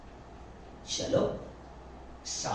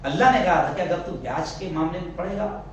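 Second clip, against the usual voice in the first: usual speaking voice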